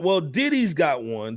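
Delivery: normal talking voice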